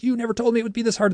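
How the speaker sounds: high, rushed, freaking-out protest; retold emotional yelling. Freaking out at these things, retold yelling. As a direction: protest fast in a high, upset voice, short of a full yell. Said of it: "I'm yelling at these things".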